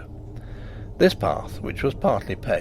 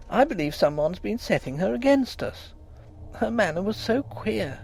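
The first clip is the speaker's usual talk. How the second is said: higher, fretful wife's voice; upset young wife; 9 semitones higher than usual. A narrator's reading. Wife telling her husband the maid is leaving, upset. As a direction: voice her tearful complaint.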